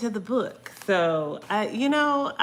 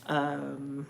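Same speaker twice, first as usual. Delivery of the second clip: ordinary delivery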